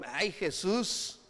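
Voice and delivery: slow, drawn-out "ay", lightly acted; mock dismissive sigh. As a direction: brush it off with a long, half-hearted groan. Acting Peter brushing Jesus off. (desdén fingido, alargado)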